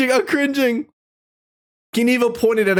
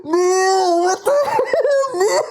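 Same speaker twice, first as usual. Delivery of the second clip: high, slowed disbelieving yelp; appalled laugh; shocked laughing outcry; 6 semitones higher, slower than usual. Real, not acted. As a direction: scold the screen while cracking up. Shocked at the AI story.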